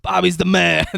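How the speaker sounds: loud, high defiant yell at the crowd; yelling back. Retold or imitated. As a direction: shout down the booing fans. Lone fan yells back at crowd.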